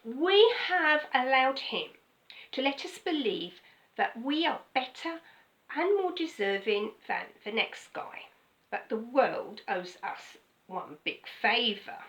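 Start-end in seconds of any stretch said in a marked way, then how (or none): none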